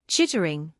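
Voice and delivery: chitter like a tiny squirrel. Pretend. Squirrel sound for children.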